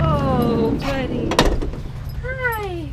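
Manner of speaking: loud high dog greeting; talking to the dog. Real, not acted. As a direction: greet the pup sweetly.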